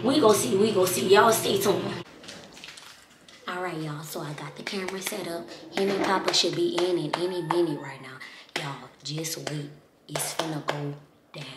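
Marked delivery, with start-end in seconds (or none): 3.5-11.5 very soft, low near-whisper; hushed secret setup. Real, not acted. Prankster to viewers before they walk in, hushed. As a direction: whisper it low, like you might be caught. Secretly setting up hidden camera.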